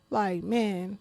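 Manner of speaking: ordinary talk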